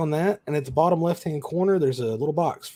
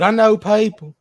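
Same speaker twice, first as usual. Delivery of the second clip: put-on thick Kentucky accent; heavy Kentucky accent; 7 dB louder than usual. Shown as a demonstration. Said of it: "who talk like this right here".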